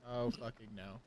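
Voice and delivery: ordinary talk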